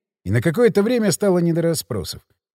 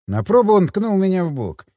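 usual speaking voice

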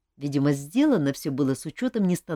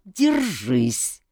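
angry; 3 dB louder than usual. Acted. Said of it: "рассердился его величество".

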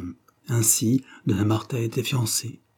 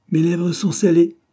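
higher, louder voice for the elated promoter; excitable businessman; 9 semitones higher, 7 dB louder than usual. A narrator's reading. Pollack refuses Drouce a tip, excitedly. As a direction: declare it high and emphatically.

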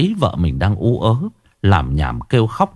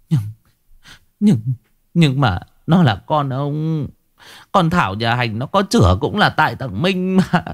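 halting, higher-pitched sobbing objection; tearful protest; 5 semitones higher than usual. Acted. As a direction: stammer a plea through tears. Weeping wife timidly argues back. (nghẹn ngào, ấp úng phản đối)

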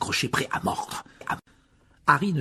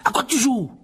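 high, loud accusing shout; angry frightened cry; 13 semitones higher, 5 dB louder than usual. A narrator's reading. Justin shouts at Harry, furious and frightened. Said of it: "furieux et effrayé".

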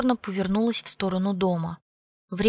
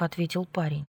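plain reading voice

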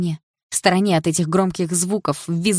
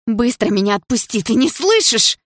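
high, loud, out of control; furious demand; 7 semitones higher, 5 dB louder than usual. A narrator's reading. Girl demanding Дима release her, losing control. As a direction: build to a furious cry. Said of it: "прошипела я, перестав контролировать себя".

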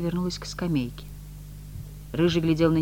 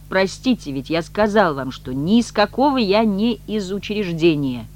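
raised, slow emphatic denial; louder; 4 semitones higher, 6 dB louder, slower than usual. A narrator's reading. Messenger correcting her about the institution, louder. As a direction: spell it out with impatience. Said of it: "заговорил громче".

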